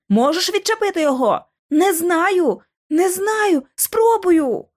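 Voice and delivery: high-pitched, agitated exclamations; frantic urgency. A narrator's reading. Two girls frantically freeing a stuck boy, urgent.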